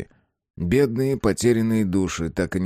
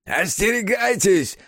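Isaiah Ward shrieking; 13 semitones higher than usual. Acted. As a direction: screech the warning like a fanatic. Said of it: "взвизгнул Исайя Ворд".

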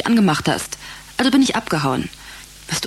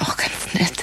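whispered tease; 4 dB quieter than usual. Acted. Teasing aside.

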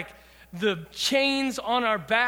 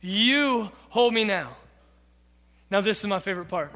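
ordinary talk, nothing marked

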